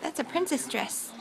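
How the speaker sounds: ordinary talk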